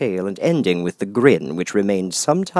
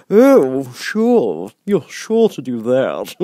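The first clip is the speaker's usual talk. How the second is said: grinning Cheshire Cat; 5 semitones higher, 9 dB louder, much slower than usual. Acted. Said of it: "said the cat".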